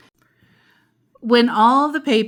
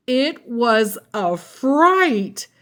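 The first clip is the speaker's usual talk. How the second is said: slow, drawn-out spooky line; slow spooky reading; 4 semitones higher, much slower than usual. A narrator's reading. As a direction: draw it out slowly for a scare.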